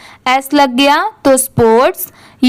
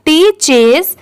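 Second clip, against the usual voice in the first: ordinary delivery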